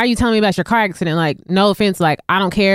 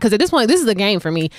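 usual speaking voice

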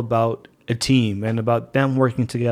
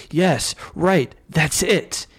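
reading as Picard; 3 semitones higher, much slower than usual. Acted. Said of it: "And then Picard replies".

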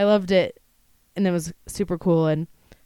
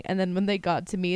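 normal talking voice

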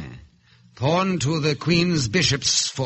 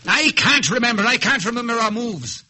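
high, loud cry of frustration; raised voice, flustered and high; exasperated outburst; 10 semitones higher, 4 dB louder, much faster than usual. Acted. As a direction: burst out, giving up in frustration. Breaks down over imagined game.

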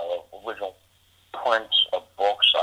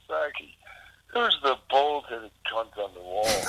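high, slow mocking imitation of the drummer; slow, high imitation of a rowdy mate; 3 semitones higher, slower than usual. Friend asking about the poster, slow and high. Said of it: "he goes".

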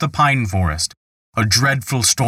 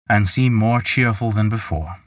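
plain reading voice

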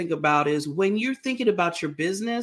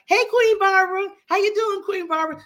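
much higher, louder cheerful hello; cheery greeting; 13 semitones higher, 5 dB louder than usual. Retold or imitated. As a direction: call out a bright greeting. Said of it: "They be like".

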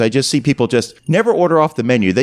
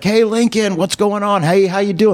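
higher-pitched, hearty hello to a regular; friendly greeting; 8 semitones higher than usual. Acted. Truck stop crowd greeting Lincoln, friendly greeting. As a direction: call out to a well-known pal.

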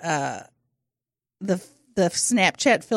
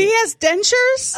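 much higher, slow astonished question; 10 semitones higher, 3 dB louder, slower than usual. Real, not acted. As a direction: gasp it in disbelief. Shocked by Biden dentures news.

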